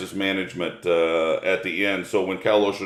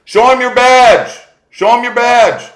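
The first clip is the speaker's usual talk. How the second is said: much higher, much louder shout; yelling across the field; 11 dB louder than usual. Retold or imitated. Farmer yelling to the inspector chased by a bull, loud shout. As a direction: holler it out to the man being chased. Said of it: "the farmer says".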